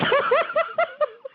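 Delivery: high burst of laughter; loud amused laugh. Real, not acted. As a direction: crack up at her own sarcasm. Laughs after sarcastic retort.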